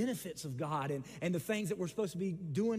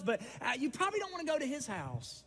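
ordinary talk

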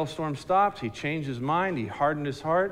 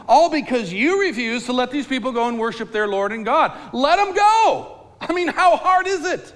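much higher, louder, fed-up appeal; exasperated officials; 9 semitones higher, 7 dB louder than usual. Acted. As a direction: officials telling Pharaoh the country is ruined, exasperated. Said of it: "looking at Pharaoh and saying".

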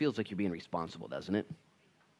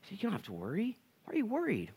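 higher and softer, tender; gentle Lord; 12 semitones higher, 6 dB quieter than usual. Retold or imitated. The Lord soothing a worried believer, soft and high. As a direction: soothe a fretting child. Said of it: "He's like".

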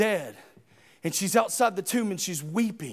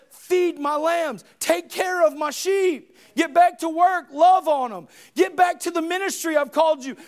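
rising commanding charge; 7 semitones higher, 4 dB louder than usual. Acted. Jesus sending Peter back to the ministry, loud. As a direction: build it into a command.

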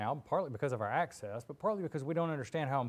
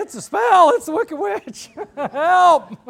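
high, loud, panicky shouting; frantic Pete; 19 dB louder, slower than usual. Retold or imitated. Pete flailing his arms, shouting the warning high and loud. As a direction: flail and yell it in alarm. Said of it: "people like Pete who stand here flailing his arms saying".

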